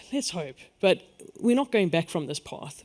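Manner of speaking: ordinary delivery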